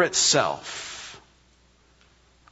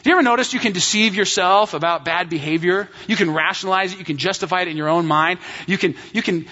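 normal talking voice

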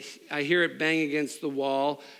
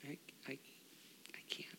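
put-on whispered, much softer child's reply; small scared whisper; 2 semitones higher, 21 dB quieter, much faster than usual. Little daughter answering her furious dad, frightened whisper. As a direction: barely breathe it out like a frightened little girl.

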